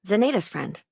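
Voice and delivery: ordinary talk, nothing marked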